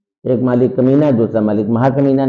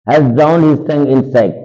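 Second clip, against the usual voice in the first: slow, louder recital of the line; slow dramatic reading; 2 semitones higher, 4 dB louder, much slower than usual. Shown as a demonstration. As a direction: read it out like a stage line, slowly. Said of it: "teacher reads text slowly". (धीमा नाटकीय पाठ)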